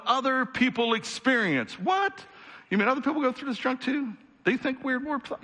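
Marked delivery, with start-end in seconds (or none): 1.8-5.4 incredulous listener. Acted. Voices doubting listener's reaction.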